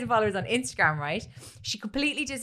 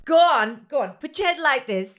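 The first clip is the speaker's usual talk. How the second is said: loud, raised urging; imitated coaching mother; 4 semitones higher, 6 dB louder than usual. Mother poses her crying son for the video, mock pushy. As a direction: mimic a pushy director urging the child on. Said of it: "instructs him to, like, wipe a tear".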